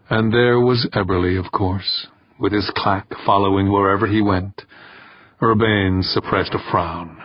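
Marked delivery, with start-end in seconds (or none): none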